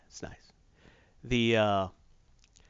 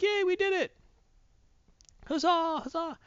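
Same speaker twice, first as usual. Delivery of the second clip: much higher, giddy cheer; mock cheering. Survivors cheering after killing the worm, giddy high mock cheer. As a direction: cheer it like cartoon victors.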